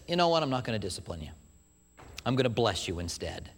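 tender and calm, letting them off; God speaking gently. Acted. God gently telling a sinner he's blessed, soft and low.

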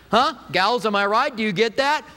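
ordinary talk

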